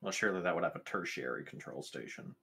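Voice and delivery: ordinary talk, nothing marked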